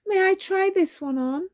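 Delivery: put-on high hopeful girl's call; calling out politely. A narrator's reading. Cinderella calling to the Grand Duke, high and hopeful. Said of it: "she called to the Grand Duke".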